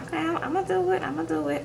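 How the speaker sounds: lightly voiced meek fiancée saying yes; imitating Rebecca. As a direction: promise it small and high like a compliant fiancée.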